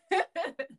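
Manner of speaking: a short high laugh; quick high giggle; bright laugh. Real, not acted. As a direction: burst out laughing. Laughing before Coliseum story.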